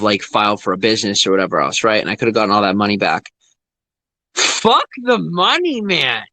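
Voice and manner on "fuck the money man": high, drawn-out angry cry; defiant outburst. Real, not acted. Dismissing lost money to viewers, defiant outburst. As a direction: throw it off with a long, soaring curse.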